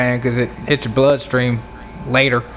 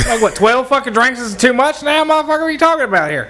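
indignant rant; 8 semitones higher than usual. Pretend. Mock outrage at being cut off.

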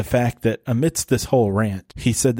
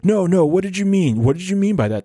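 higher, mock-indignant pressing; insistent questioning; 6 semitones higher than usual. Guy homes in on the lying remark, insistent. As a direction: lightly act out demanding an explanation.

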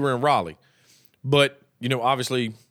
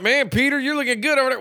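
higher, teasing catcall to a buddy in a dress; mock flirting; 8 semitones higher than usual. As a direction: flirt jokingly, pitched up. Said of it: "Be like".

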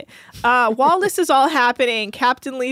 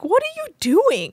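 incredulous; 12 semitones higher, 3 dB louder than usual. Retold or imitated. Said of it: "It's like".

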